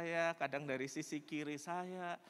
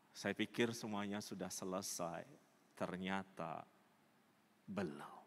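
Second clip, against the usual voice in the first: low, soft, halting admission; subdued confession; 5 semitones lower, 4 dB quieter than usual. Acted. Woman confesses last year's abortion to pastor, subdued confession. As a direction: confess it heavily, with long pauses.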